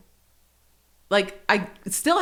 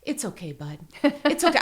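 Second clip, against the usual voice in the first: comforting, forgiving; 3 semitones higher than usual. Retold or imitated. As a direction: gently soothe a child, retold. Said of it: "comforted him and forgave him".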